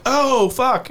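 high startled curse. Retold or imitated. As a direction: caught off guard.

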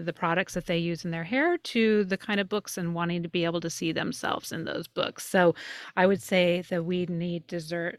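ordinary talk, nothing marked

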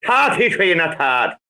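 Irish "thirty-three" with t for th; Irish accent. Shown as a demonstration. Asked to say thirty-three and a third, broad Irish accent. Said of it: "in an Irish, Irish accent".

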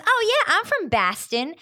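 mock the bad "Boston", first vowel as in "bat"; terrible Boston pronunciation. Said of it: "it's so terrible".

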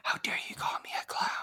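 whispered outrage; a drag queen insult whispered like ASMR; mock ASMR whisper. Joining the mock ASMR fight.

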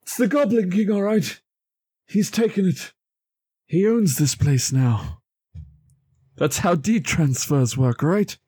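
a touch of the high showman voice announcing the culprit; [name] calling back. Acted. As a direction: call the discovery across the room. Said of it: "just call back".

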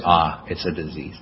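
low, slow mock realization; puny mind pondering. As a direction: say it slowly, low and soft, as a mock discovery. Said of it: "puny mind gonna sit there and say".

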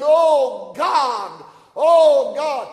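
loud, high pleading wail, acted; crying out to God. Preacher acting out a desperate prayer for the congregation, crying out loud. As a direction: cry it out loudly as a preached prayer. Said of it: "talking to God and weeping out of his words".

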